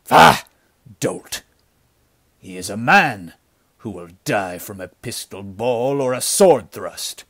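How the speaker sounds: loud, scornful outburst. A narrator's reading. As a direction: berate a foolish underling. Leader scorns his frightened man.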